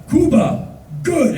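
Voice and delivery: high, emphatic short words; proud toast. Retold or imitated. Bus driver praises his country to tourist, proud and high. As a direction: declare it like a simple toast.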